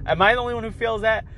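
ordinary talk

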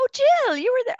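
suddenly spotting the friend who was there; sudden realisation. Real, not acted. To Jill, realising she was at the dinner, surprised.

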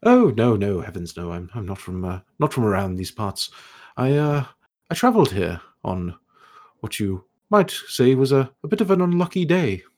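put-on high, airy voice of a curious old elf scholar; scholarly elf ghost. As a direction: play the puzzled, polite ghost scholar, pitched high. Ghost answers the question.

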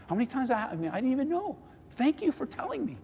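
high-pitched earnest surprise; surprised, humble gratitude. Acted. Acts out grateful reply to correction.

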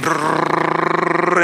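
lip-buzzed boat motor brrrr. Acted. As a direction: imitate a motorboat engine with the lips. Motorboat engine sound.

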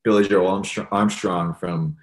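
ordinary delivery

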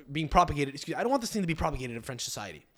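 ordinary talk, nothing marked

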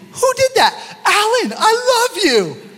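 high-pitched laughing reaction to the slide; laughing surprise at a joke slide; amused high squeal. Real, not acted.

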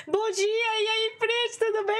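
high, slow sing-song good morning; bright hammed-up hello; cheery greeting.

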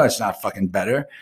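usual speaking voice

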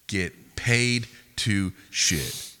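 "Get paid to shit" very slow, with gaps between words; word-by-word emphasis. Real, not acted. Repeating it to the guys, "Get paid to shit" very slow and deliberate. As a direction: spell out "Get paid to shit" one loud word at a time. Repeats slogan even more slowly.

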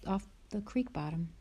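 plain reading voice

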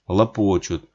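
ordinary delivery, nothing marked

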